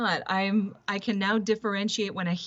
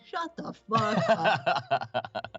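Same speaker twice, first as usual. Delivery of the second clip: retold exasperation; much slower than usual. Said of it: "And I'm like".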